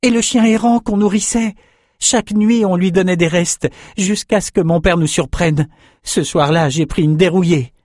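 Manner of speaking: high, urgent storytelling; pleading young fighter. A narrator's reading. Swordsman recalls their stray dog to his friend, urgent pleading. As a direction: open very high, easing slightly as the memory unfolds.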